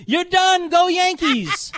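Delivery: a high, loud cheering yell, slow on Go Yankees. Retold or imitated. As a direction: shout it out like a nurse celebrating. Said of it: "she yells out".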